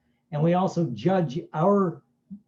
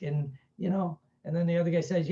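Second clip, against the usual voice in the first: ordinary delivery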